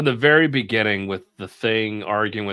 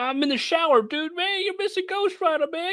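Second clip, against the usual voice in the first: hammed-up guy hollering about Ghost Rider; mock neighbor calling out. Comic book bystander yelling to his buddy, high hammed-up bro.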